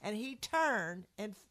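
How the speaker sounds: ordinary talk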